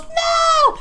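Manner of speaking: sudden high yelled no; mock scream of a frightened witch; retold scream. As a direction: shriek it like her power bursts out. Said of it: "She goes".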